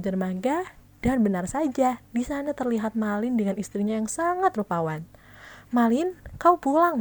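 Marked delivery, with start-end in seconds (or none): 5.7-7.0 joyful loud call across the dock. Acted. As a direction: call out to her son with joy. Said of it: "seru ibunya".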